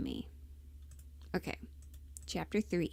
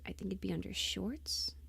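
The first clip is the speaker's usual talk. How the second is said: whisper; 9 dB quieter than usual. Real, not acted. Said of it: "whispering aside".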